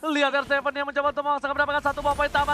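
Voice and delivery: rapid hyped esports call; fighting game announcer. Acted. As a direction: ride the fight like a ringside caster. Live teamfight play-by-play.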